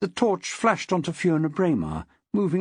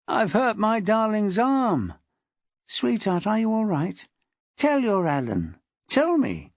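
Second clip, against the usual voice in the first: higher-pitched, wheedling whine; whining; 6 semitones higher than usual. A narrator's reading. Husband fussing over the arm he hurt, whining. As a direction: whine with self-pitying concern for her. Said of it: "The voice was whining now, passing the blame".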